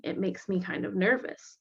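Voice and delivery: ordinary delivery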